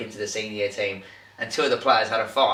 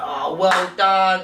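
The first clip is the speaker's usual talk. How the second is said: higher, louder, slow sarcastic praise; mock shout; 8 semitones higher, 5 dB louder, much slower than usual. Manager shouting to wind up Rigby, slow sarcastic call. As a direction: drag out sarcastic praise to needle him. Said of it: "was shouting stuff like".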